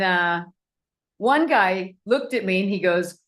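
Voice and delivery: usual speaking voice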